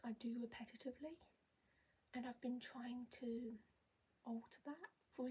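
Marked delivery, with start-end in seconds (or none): none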